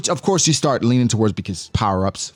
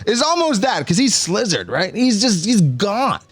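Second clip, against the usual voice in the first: ordinary talk, nothing marked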